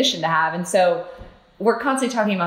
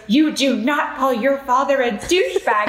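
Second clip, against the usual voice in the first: higher-pitched mock-stern reprimand; scolding, suppressing laughter; 5 semitones higher than usual. Mother retells Andy scolding their son, trying not to laugh. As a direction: scold while holding back laughter. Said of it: "trying ... not to laugh".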